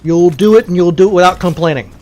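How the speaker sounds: bossy mock drill-down, loud and insistent; mock scolding. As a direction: tell off a whining soldier, playfully forceful. Mock scolding a whining game character.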